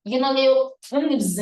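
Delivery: normal talking voice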